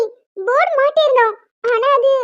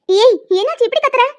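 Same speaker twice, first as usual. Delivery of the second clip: usual speaking voice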